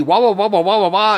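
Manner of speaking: put-on high, loud childish wah-wah babble; mock kids' babble. Father mocking his children's questions, high childish babble. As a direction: mimic the kids' nagging as high yammering nonsense. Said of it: "my children would ask me".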